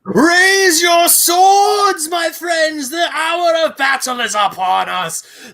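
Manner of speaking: shouted, very high heroic war cry; rally the troops with a shout; battle cry. Acted. Co-host does a battle cry.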